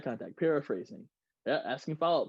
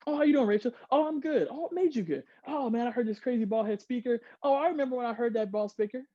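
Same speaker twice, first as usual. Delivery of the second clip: acted chatty conversation; 6 semitones higher than usual. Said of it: "Power of two".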